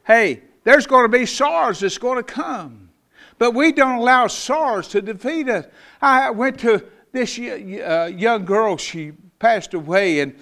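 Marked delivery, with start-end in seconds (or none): 0.0-8.5 high, loud pulpit call; rally them against sorrow; preaching. Acted. Pastor rallying his congregation, high and loud.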